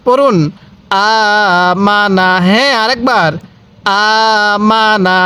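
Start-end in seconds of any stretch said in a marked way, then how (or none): 0.9-2.3 slow "আমানা" with a long first "আ"; stretched opening vowel. Shown as a demonstration. Teacher leading read-along, "আমানা" with long opening vowel. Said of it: "এরকমভাবে পড়বেন". 4.0-5.2 slow "আমানা" with a long first "আ"; stretched opening vowel. Shown as a demonstration. Teacher leading read-along, "আমানা" with long opening vowel. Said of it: "এরকমভাবে পড়বেন".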